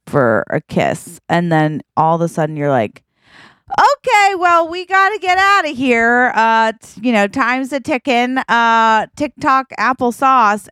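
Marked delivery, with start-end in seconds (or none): 3.7-10.7 flustered. Acted. Flustered dodge of the kiss.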